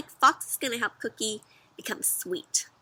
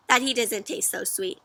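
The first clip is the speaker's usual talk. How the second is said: ordinary delivery, nothing marked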